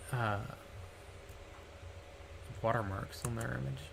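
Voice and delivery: ordinary talk, nothing marked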